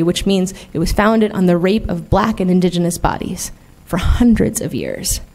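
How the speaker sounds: ordinary talk, nothing marked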